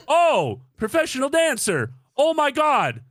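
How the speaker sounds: high, loud shocked realization; realizing she's a dancer, shocked; sudden realization. Retold or imitated. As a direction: it hits you all at once. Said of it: "that's when it dawned on me".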